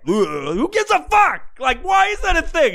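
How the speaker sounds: high, incredulous rant; exasperated shout. Real, not acted. Ranting about a pizza reviewer's ratings, exasperated shout. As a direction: burst out, pitch shooting up.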